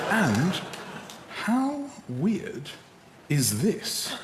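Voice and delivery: broken into pieces with pauses; word by word, as if typing it; halting, deliberate message dictation; dictating a text. Acted.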